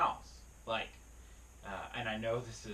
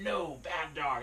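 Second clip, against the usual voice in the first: drawn-out, higher mock scold; mean scolding; 4 semitones higher, slower than usual. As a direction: imitate a harsh dog owner scolding. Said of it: "be mean to their dogs and say".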